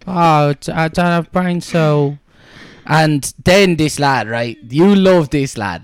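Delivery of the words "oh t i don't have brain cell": higher, slow dopey imitation; hammed-up airhead impression; mock dim-witted contestant. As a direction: drag it out like someone with no brain cell. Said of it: "you can see by the way that they talk".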